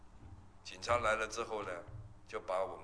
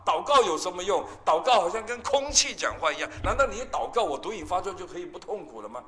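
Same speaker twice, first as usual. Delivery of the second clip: louder, higher, exasperated son snapping at his mother; mock-angry retelling of a young addict's rant; retold angry outburst; 6 semitones higher, 8 dB louder than usual. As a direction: throw it back at her, fed up. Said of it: "我已经很生气了，很火大".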